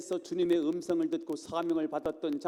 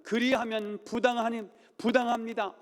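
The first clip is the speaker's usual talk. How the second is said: Moses refusing firmly; 7 semitones higher, 3 dB louder than usual. Acted. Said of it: "모세는 뭐라고 말하냐?".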